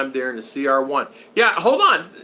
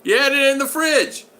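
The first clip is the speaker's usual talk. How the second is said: high, louder retort; mock retort; 12 semitones higher, 5 dB louder than usual. Retelling the cake fight with his wife, a high louder jab. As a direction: throw it back at her, high and punchy. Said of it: "I go".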